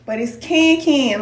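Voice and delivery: Ken Ken said slowly with the vowel of can, spelled C A N. Shown as a demonstration. Correcting a viewer, says Ken Ken slowly like can. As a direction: stretch Ken Ken so it sounds like can. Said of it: "like C A N".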